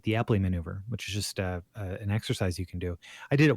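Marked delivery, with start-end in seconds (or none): 0.0-0.8 "the Epley maneuver" run through quickly; said kinda fast. Real, not acted. Telling friends what the doctor called it, said fast. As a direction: rattle off "the Epley maneuver" in passing. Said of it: "You said it kinda fast".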